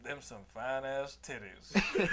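mock hillbilly voice, slow. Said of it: "and he goes".

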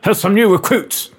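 lightly voiced army officer; old army type. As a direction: address the new recruits like an old major. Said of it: "old army type accent".

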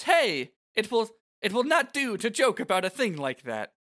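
mild high-pitched reproach; scolding townswoman. Acted. Shalinda scolding a joke.

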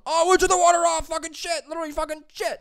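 shrieking in panic at the flood; freaking out. Retold or imitated. Boss yelling as sewage floods the basement, frantic high shriek. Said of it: "my partner and my boss were losing it".

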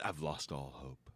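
low, soft movie-trailer voice; silky smooth low narrator; silky voiceover. Shown as a demonstration. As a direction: drop it deep and smooth, quietly. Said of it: "Man, I could do voiceover".

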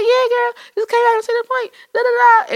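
very high chatty phone voice; retold phone chat. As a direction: put on a high-pitched gossipy call with a girlfriend. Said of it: "was on the phone like".

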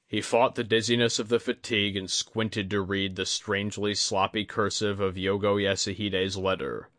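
ordinary delivery, nothing marked

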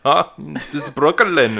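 slow, high put-on Dutch accent. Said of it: "Dutch accent".

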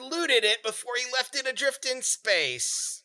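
high, drawn-out gnome outburst; hammed-up angry little gnome; indignant rage. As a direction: rail slowly at the thief in a high voice. Gnome rages about the thief.

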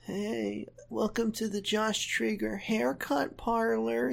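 soft, high ASMR voice; breathy salon ASMR greeting; ASMR whisper. Pretend. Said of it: "Josh voice A S M R".

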